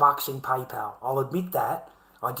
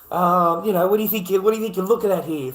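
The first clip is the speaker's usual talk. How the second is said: louder, higher, fast and exasperated; indignant outburst; 5 semitones higher, 6 dB louder, faster than usual. Real, not acted. Ranting at the police about the search, louder and higher. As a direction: scoff at how absurd the suspicion is.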